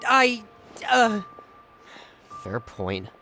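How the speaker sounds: flustered, then deflated; sheepish concession. Acted. Caught out, stammers and concedes.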